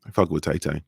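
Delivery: low, soft backing-off voice; a hushed, nervous no thanks; dropped low and quiet, shaking it off; mock scared hush. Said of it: "I'm like".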